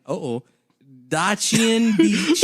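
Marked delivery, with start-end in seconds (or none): 1.1-2.4 loud, slow, drawn-out place name; stretched, shouted name; mock dramatic. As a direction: belt it out like a big reveal.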